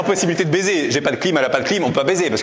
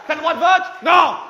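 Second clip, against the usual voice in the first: angry Swiss local; 11 semitones higher than usual. Acted. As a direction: snap it at the crowd.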